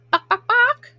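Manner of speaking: high taunting chicken clucks; mock chicken clucks. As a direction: cluck at him like hens to mock. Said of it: "they shouted".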